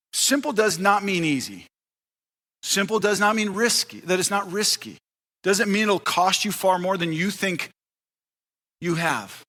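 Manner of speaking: ordinary talk, nothing marked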